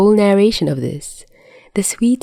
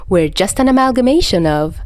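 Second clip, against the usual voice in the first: ordinary delivery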